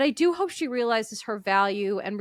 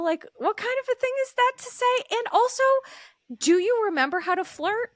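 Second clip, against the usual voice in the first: mock outraged scolding; 12 semitones higher, faster than usual. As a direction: scold Carl in high disbelief. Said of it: "And I was like".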